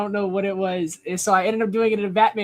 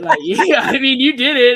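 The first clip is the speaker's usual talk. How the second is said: laughing; 7 semitones higher, 9 dB louder than usual. Retold or imitated. [name] amused at E C three.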